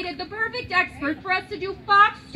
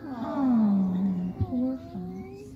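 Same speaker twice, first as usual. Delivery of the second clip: mock sad aw; much slower than usual. Shown as a demonstration. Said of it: "Can you guys do that with me?".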